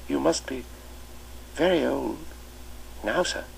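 put-on shy new boy asking at the door; timid small boy. Boy's innocent remark.

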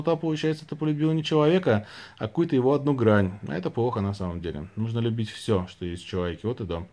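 ordinary delivery

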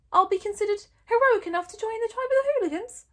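high loud disbelief; sarcastic outraged question; incredulous. Acted. Hiccup questioning his father, high and incredulous.

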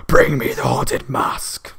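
a hint of a spooky ghost girl, whispered and slow; breathy slow ghostly threat; feminine scary voice. Ghost demanding the mask, mildly spooky whisper. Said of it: "in a more feminine, scary voice".

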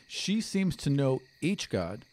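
ordinary talk, nothing marked

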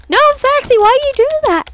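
high, loud cry of dismay; exasperated protest. Real, not acted. Player scolding own character over a reply, high exasperated yell.

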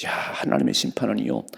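ordinary delivery

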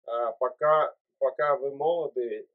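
ordinary talk, nothing marked

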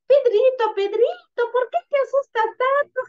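put-on very high-pitched, teasing girl puppet. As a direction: squeal it like a little puppet. Girl puppet teases frightened Pedrito. (niña títere con voz muy aguda)

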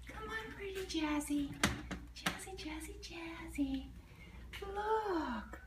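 high, sing-song coo to a shy cat; gentle high pet voice. Real, not acted. Calling the cat softly.

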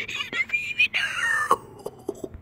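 high-pitched delighted squeal; squealing glee. Real, not acted. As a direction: squeal it slowly in triumph. Repeats as squealing cry.

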